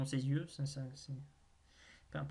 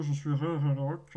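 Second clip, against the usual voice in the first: louder, put-on robot-golem voice; mock Rigirock voice; 5 dB louder than usual. Streamer playing Rigirock greeting viewers, mock golem voice. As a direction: boom it out as the stone golem. Plays the Pokémon introducing itself.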